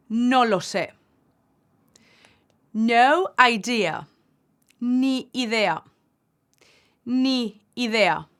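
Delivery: slow, careful teacher model reading; clear phrase drill. Acted. Teacher modelling beginner phrases for students, clear drill voice. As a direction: say each phrase clearly for learners to repeat.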